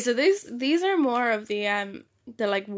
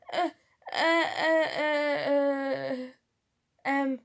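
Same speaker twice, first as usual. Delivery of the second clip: mock panic stammer; 4 semitones higher than usual. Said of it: "panic, full on panicked".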